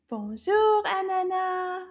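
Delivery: high, loud welcome with held ending; calling a greeting. Real, not acted. Teacher welcomes Anana to class, calling out a greeting. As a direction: call out brightly to bring her in.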